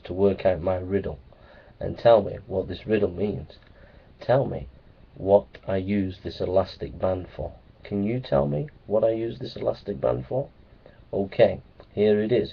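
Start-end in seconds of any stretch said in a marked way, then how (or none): none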